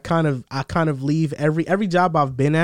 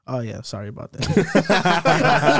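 very slow, casual sorry through laughter; breezy mock apology dissolving into laughs; laughing non-apology; much slower than usual. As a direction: shrug it off, laughing.

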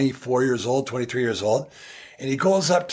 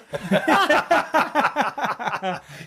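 big high laugh bursting out; 10 semitones higher than usual. Real, not acted. Laughing at Herbie ignoring his handshake, high laugh. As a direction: laugh hard at the memory.

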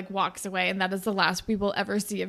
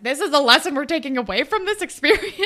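louder, higher mock-preachy line, fast; sarcastic preachy moral; 6 semitones higher, 9 dB louder, faster than usual. Joking about Allie's lesson, mock preachy.

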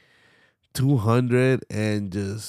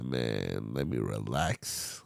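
relaxed; 11 semitones lower, 11 dB quieter, much slower than usual. Retold or imitated. Retelling his choice to skip paying.

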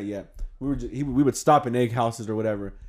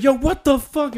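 high, louder outraged yell; angry outburst; 13 semitones higher, 6 dB louder than usual. Retold or imitated. Alex yelling at friends over egg in his car, outraged.

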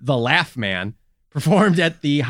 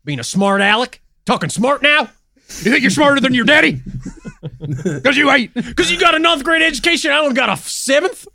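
put-on loud, high drawling dad yelling at his kid; 6 semitones higher, 3 dB louder than usual. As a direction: chew him out like an angry hillbilly father. Voicing angry redneck daddy.

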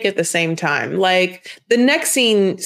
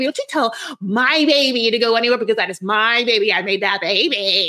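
mock possessive mother; 4 semitones higher than usual. Mocking Chevelle's mom claiming her daughter at the party. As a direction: ham up a clingy mother repeating my baby. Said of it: "Chavelle's mom is like".

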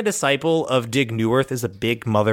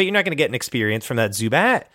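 usual speaking voice

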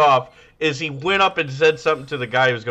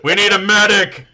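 raised, loud call for help; mock urgent call; 8 semitones higher, 8 dB louder than usual. Podcaster imitating show crew as contestant collapses, urgent shout. Acts out crew calling for medic.

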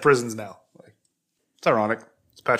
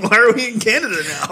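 laughing through the line, higher; 10 semitones higher, 4 dB louder than usual. Retold or imitated. Laughing at the sudden Canadian bit. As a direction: giggle it out. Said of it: "I'm like".

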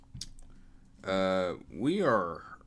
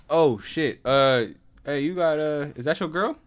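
loud, raised-pitch retold bluff; playing it off; 6 semitones higher, 8 dB louder than usual. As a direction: imitate a friend covering a blunder out loud. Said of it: "Josh played it off hella smooth".

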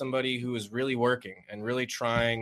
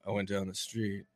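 softer deadpan with long pauses; slow pandering deadpan; 3 semitones lower, 6 dB quieter than usual. Retold or imitated. Comic imitates a deadpan stand-up telling a story, slow and subdued. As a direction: drop the energy, dole out each phrase. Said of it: "like, slow, pandering, deadpan".

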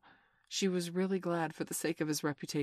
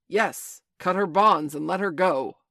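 loud, imperious pirate captain; forceful, raised captain's command; commanding shout; 3 semitones higher, 11 dB louder than usual. A narrator's reading. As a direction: bellow the order across the water. Fake Hook shouts an order.

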